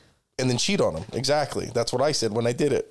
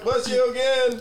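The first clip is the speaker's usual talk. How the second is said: high sing-song blessing; mock bless you; 11 semitones higher, slower than usual. Mocking the repeat blessing, high sing-song. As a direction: lift it up sweetly. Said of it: "you gotta fuck".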